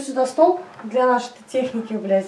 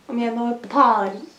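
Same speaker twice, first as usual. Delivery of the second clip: normal talking voice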